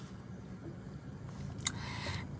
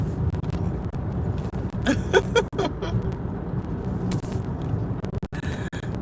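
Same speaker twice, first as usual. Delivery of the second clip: soft laugh; 10 semitones higher, 6 dB quieter than usual. Real, not acted. Laughs at the devil interfering.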